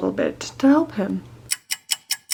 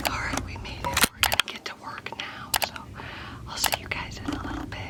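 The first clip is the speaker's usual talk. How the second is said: whispering; 12 dB quieter, faster than usual. Real, not acted. Whispering on arrival, dad asleep.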